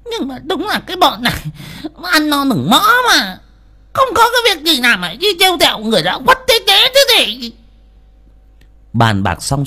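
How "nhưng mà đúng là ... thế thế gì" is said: very high, complaining mother; upset mother. A narrator's reading. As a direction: grumble shrilly about those foolish youngsters. Mother voiced in narrated story. (giọng bà mẹ the thé phàn nàn)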